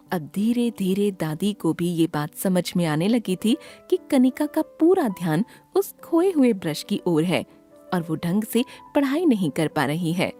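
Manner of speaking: ordinary delivery, nothing marked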